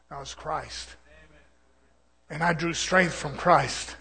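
usual speaking voice

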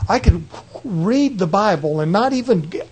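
ordinary delivery

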